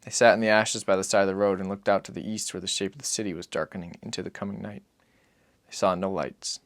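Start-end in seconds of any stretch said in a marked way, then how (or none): none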